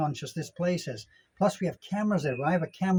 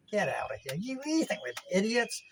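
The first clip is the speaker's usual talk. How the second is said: mock scoffing; 4 semitones higher, 5 dB quieter than usual. Scoffs at the expat video makers.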